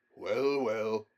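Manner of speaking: gruff, loud grumble; a king grumbles, letting it pass; grumbling. A narrator's reading. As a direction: grumble and give in. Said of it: "grumbled the king".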